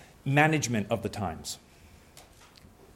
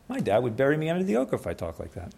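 ordinary delivery

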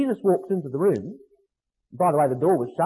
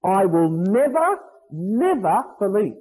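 louder, slow, with both NEVERs punched; emphatic negative; 4 dB louder, slower than usual. Acted. Preacher repeating Thomas's vow to the congregation, slow emphatic double never. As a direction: drive home never, never with a pause between them. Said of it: "That's what Thomas said".

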